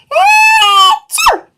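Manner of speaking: a high, loud achoo; loud sneeze. A narrator's reading. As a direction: sneeze from fur tickling the nose. Fur tickles nose, sneeze.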